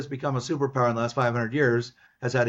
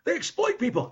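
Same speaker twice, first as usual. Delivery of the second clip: much higher, mocking whiny complaint; mock whine. Mocking his lefty students, high whiny parody.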